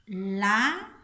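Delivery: "La" held long and loud; "La" isolated as the accented syllable; stressed syllable. Shown as a demonstration. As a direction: hit "La" hard, letting it rise. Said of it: "el acento".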